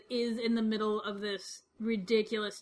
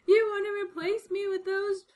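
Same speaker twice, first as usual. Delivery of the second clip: higher, wounded mock voice; imitating the crying actress, higher; upset actress; 10 semitones higher, 3 dB louder than usual. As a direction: play the hurt actress, pitched up. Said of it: "She's like".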